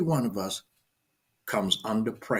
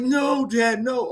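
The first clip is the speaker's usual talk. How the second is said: put-on young daughter's protest; 14 semitones higher, 5 dB louder, much slower than usual. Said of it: "and she goes".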